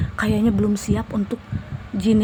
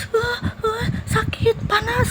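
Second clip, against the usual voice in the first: much higher, whining cries of pain; high mock wail of pain; jinn in pain; 13 semitones higher, much slower than usual. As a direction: squeal like a burning spirit. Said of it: "itu langsung dia tuh".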